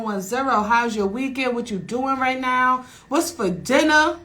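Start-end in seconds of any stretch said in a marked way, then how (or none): none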